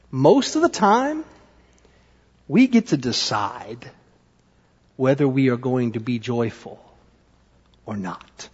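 low, soft, spaced-out lesson. Real, not acted. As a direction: let each phrase land gently with pauses. First take of the lesson.

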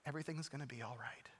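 low, very soft comfort; tender reassurance. Retold or imitated. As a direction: voice God's quiet promise to a frightened heart. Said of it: "what I knew God was saying to me".

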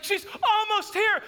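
high, urgent wife imitation; mock panic. Wife warns family the visitor is close, panicked. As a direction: sound the alarm, hammed up.

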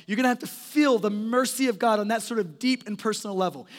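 usual speaking voice

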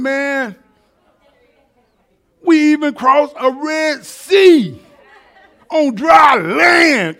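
high, loud, shouted preaching; full-force preaching. Acted. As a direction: preach it at the top of your voice.

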